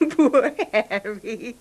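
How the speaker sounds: mocking laugh through the words; laughing pity. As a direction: laugh at him while pitying him. Wife mocks pitiful husband.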